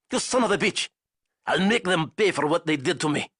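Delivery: angry outburst. Acted. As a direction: spit out the threat in fury. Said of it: "Zayson said".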